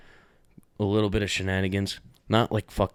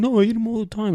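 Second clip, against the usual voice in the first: mock dad protest; 13 semitones higher, 5 dB louder than usual. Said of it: "He was like".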